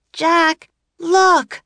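high, bright little sister calling Jack; girl's voice. A narrator's reading. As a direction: pitch up high for the young girl.

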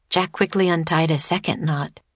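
ordinary delivery, nothing marked

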